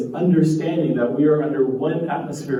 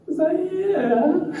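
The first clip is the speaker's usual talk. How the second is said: high, rising mock bafflement; puzzled squeak; slower than usual. Presenter mocks her confusion over a chat reply with a high squeak. Rereading the chat word as yeah.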